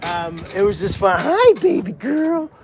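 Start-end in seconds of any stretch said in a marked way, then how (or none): 1.2-2.5 high, slow cooing at a pig. Real, not acted. Owner greeting her pig, in high cooing baby talk.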